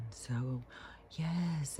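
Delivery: soft, hushed mock-serene voice; mock zen. Mocking spiritual people who all talk alike, mock zen. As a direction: imitate a put-on calm, nearly whispered. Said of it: "they all try and be so zen".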